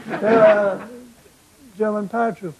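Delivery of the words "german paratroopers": ordinary delivery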